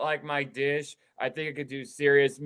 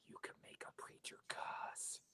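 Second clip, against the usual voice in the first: whisper; 26 dB quieter than usual. Real, not acted. Quoting, whispered line.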